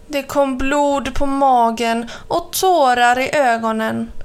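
ordinary delivery

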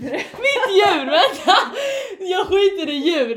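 high, loud laughing outburst; cracking up. Real, not acted. Friend laughing at her own slip about a pet, cracking up. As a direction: lose it laughing while correcting yourself.

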